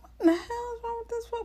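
high, fast, incredulous inner voice; high and fast, baffled by the fool; exasperated thought. Retold or imitated. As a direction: let the disbelief fly out quickly. Thinking back on his attitude.

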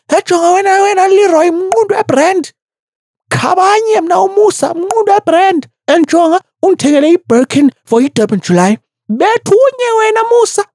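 put-on very high, fast furious screech in a thick Xhosa accent; Mihlali. Imagined Mihlali beating someone up in the office, high angry screech. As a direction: imitate a woman screeching mid-brawl. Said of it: "sounds when she's fucking pissed off and angry".

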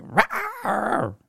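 a sudden high mock roar from the aunt. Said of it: "she'd flash on me like".

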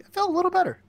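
very high, loud little voice; mock cheery self-talk. Someone who just started loving themselves, in mock high cheer. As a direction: pipe up high, as if suddenly cheered.

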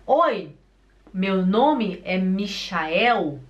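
slow introduction with Michael as mi-sha-EW; Brazilian pronunciation. Acted. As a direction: say Michael with SH and final W, slowly. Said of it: "se ele chega e fala". (Michael abrasileirado, mi-cha-éu)

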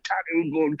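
ordinary delivery

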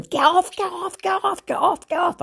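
very high, repeated mock scream; imitated screaming. Woman mimicking the one she had pinned, imitated screaming. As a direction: shriek it high on one pitch, mimicking her. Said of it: "she's screaming and she's yelling".

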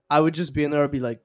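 ordinary talk

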